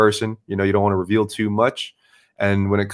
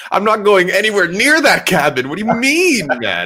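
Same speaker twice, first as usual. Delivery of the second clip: much higher, louder, imitating his own panic; mock scared; 11 semitones higher, 6 dB louder than usual. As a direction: squeal it like you're scared of the cabin. Retelling his own fear at the cabin.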